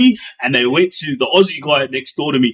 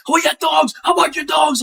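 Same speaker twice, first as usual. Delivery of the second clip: much higher mock shout while coughing; retold swearing shout; 11 semitones higher than usual. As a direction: yell abuse at the guards, hammed up. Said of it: "he was swearing".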